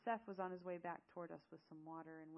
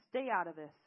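loud warning, pitch held down; angry yell; 14 dB louder than usual. Acted. Zale warning Eamon off, yelling. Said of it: "Zale yelled at Eamon".

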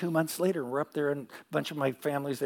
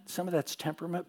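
ordinary talk

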